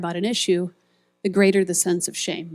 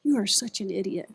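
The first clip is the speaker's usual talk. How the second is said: softer, muttered self-insults; self-cursing; 6 dB quieter than usual. Retold or imitated. Speaker voicing the inner self-cursing, softer. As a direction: berate yourself under your breath. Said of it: "we start saying things like".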